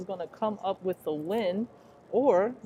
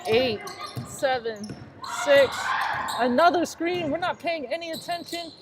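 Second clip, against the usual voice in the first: much higher and louder, racing call; excited play-by-play; 8 semitones higher, 3 dB louder than usual. Real, not acted. Commentator to fans, excited over the final seconds. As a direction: call the last seconds at fever pitch.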